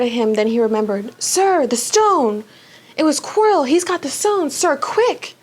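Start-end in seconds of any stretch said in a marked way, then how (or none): 1.2-5.3 higher, hurried pleading; urgent alarm. Acted. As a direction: warn the headmaster urgently. Harry urgently warns Dumbledore.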